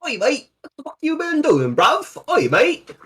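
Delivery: high put-on London geezer accent; mock Cockney. Player mocking the party, high Cockney accent. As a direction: ham up a Cockney lad greeting a mate.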